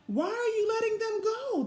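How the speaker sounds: put-on high, insistent young girl's voice; demanding girl. A narrator's reading. Fiona demanding the guide free no butterflies, high and insistent. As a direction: demand to know why, like a little girl. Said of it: "demanded Fiona".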